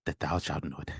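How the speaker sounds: lightly mocked solemn verse, low and soft; a hint of breath in a put-on poet voice; breath after words. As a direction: drop into a hushed archaic recital. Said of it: "like, that breath".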